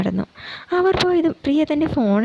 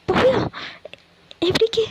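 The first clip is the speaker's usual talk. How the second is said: alarmed surprise; 10 semitones higher than usual. Acted. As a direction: shoot up in alarm. Shocked he has left. (ഞെട്ടി ഉയർന്ന ശബ്ദത്തിൽ)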